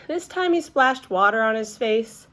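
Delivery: plain reading voice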